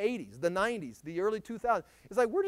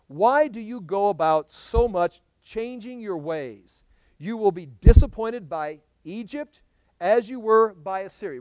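loud, slow proclamation; scripture reading; 12 dB louder, slower than usual. Acted. Preacher reading Jeremiah's rebuke to the congregation, loud and slow. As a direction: declaim the verse slowly, with force.

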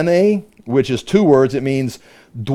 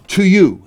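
"To you" lifted higher and pointed; emphatic; 4 semitones higher, 3 dB louder than usual. Acted. Satan addressing Jesus directly, emphatic. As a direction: press "To you" straight at the listener. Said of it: "And that is emphatic, meaning".

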